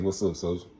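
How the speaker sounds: ordinary talk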